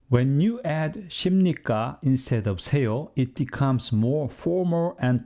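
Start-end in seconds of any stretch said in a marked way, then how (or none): none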